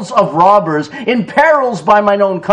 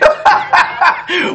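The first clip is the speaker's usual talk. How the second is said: delighted laugh; 12 semitones higher than usual. Real, not acted. Laughing at the story.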